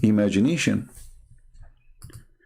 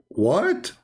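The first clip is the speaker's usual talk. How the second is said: incredulous; 7 semitones higher than usual. Real, not acted.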